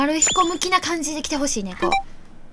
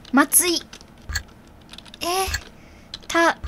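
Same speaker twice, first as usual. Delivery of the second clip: ordinary delivery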